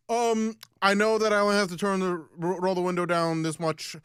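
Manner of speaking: loud, awkward know-it-all to an officer; hammed-up pedant insisting on his rights; spectrum-y. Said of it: "very spectrum-y".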